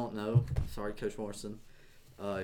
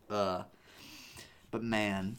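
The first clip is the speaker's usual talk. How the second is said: normal talking voice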